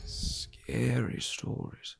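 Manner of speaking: slow spooky whisper. Acted. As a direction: breathe it out eerily. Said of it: "Scary stories around the campfire".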